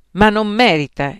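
loud scornful exclamation; indignant. A narrator's reading. Plump friend scolding Santippe about Socrates, indignant. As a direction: cut in with disdain. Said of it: "esclamò con sdegno".

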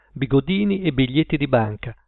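ordinary delivery

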